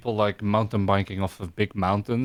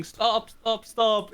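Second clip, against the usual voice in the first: mock scream; 11 semitones higher, much slower than usual. Retelling fallen kids on icy bike lane, mock screaming. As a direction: imitate kids screaming a warning. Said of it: "we saw them screaming".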